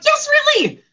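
loud, very high mock insistence; hammed-up exasperated confirmation; emphatic comeback. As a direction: throw it back loud and high. Coach's emphatic comeback in acted exchange.

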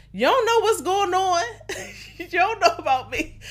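high, laughing mock protest at a friend; mock defensive. Brushing off a friend who checks on you, mock defensive whine. Getting mad at pushy friend.

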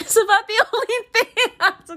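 high, laughing the words out; cracking up at her own joke. Real, not acted. Joking about parents' rules, laughing hard.

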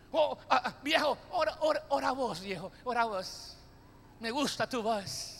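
soft, drawling plea; lightly mocking tired husband voice; lazy husband. Lazy husband urging wife.

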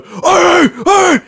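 loud, high dog barks; sharp, high-pitched yaps; imitated dog barking. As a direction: bark it out like an excited dog. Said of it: "this dog was just going".

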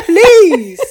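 loud, slow, laughing scoff; loud scoffing please. Retold or imitated. Reacting to Auntie's print top, a loud laughing scoff. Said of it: "I'm thinking".

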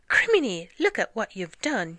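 gasp, then loud, high exclamation; shocked outburst. A narrator's reading. Gasping outburst at something discovered.

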